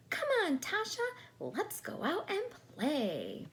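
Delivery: very high and loud call; squeaky high excited doll; playful doll voice. Acted. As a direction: call out like a tiny magic doll. Doll calling Natasha.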